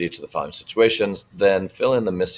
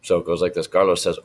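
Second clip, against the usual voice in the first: normal talking voice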